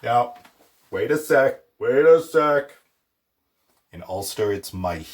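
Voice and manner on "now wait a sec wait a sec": mock protesting viewer. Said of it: "you were like".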